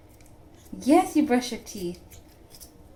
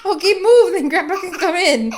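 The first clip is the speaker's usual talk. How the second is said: laughing; 4 semitones higher, 7 dB louder than usual. Real, not acted. Laughing, shooing toddler aside.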